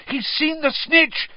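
high, drawn-out shout of discovery; excited shout. A narrator's reading. Harry spotting the seeker's dive, shouting. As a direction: shout the news out, high and slow. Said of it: "Harry shouted".

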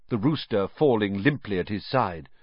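ordinary delivery, nothing marked

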